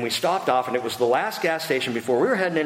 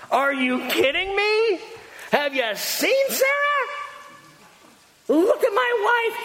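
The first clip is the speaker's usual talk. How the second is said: much higher, slow, disbelieving; incredulous Abraham; 13 semitones higher than usual. Acted. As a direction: play Abraham who can't believe it. Abraham's incredulous laugh retold.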